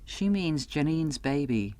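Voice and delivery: plain reading voice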